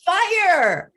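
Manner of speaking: put-on alarmed children's shout; boys yelling. A narrator's reading. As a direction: call out the warning. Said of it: "They started yelling".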